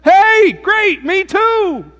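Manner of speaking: high, loud gushing delight; thrilled to find a fellow believer; delighted rescuer. Acted. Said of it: "He said".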